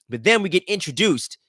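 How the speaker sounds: ordinary delivery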